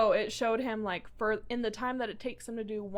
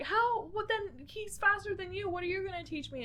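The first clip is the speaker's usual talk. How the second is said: pitched-up, indignant hero voice, fading softer; high protesting hero; 9 semitones higher, 3 dB quieter than usual. Retold or imitated. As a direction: squeak out the objection like the young hero. Retold hero protesting to mentor.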